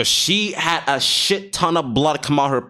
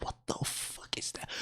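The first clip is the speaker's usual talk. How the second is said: shocked whisper; 20 dB quieter than usual. Retold or imitated. Retold shocked reaction in attic.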